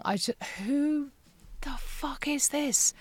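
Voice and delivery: hushed, stunned whisper at her reflection. Retold or imitated. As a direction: breathe it, barely voiced, in shock. Staring at self in mirror.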